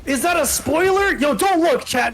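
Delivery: high, loud spoiler panic; excited shouting. Real, not acted. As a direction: yell at chat not to look. Caster panics over a spoiler.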